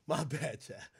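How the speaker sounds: giggling under the breath; laughing quietly. Real, not acted. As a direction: try to stop laughing. Laughing, hushed.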